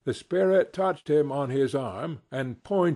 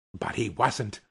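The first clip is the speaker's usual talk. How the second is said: ordinary delivery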